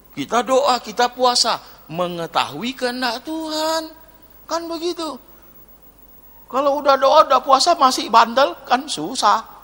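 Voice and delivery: high-pitched, insistent exhortation; emphatic preaching. Real, not acted. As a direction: preach it at the congregation with exasperation. (berkhotbah tegas bernada tinggi)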